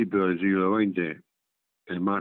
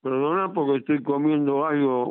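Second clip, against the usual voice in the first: ordinary talk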